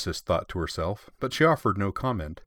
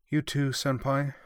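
resigned; 6 semitones higher, slower than usual. Acted. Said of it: "wondered in a resigned sort of".

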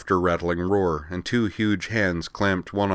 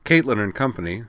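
ordinary delivery, nothing marked

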